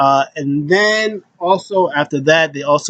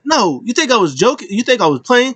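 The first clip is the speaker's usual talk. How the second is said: high mock insistence; 9 semitones higher than usual. Riddle insisting, retold.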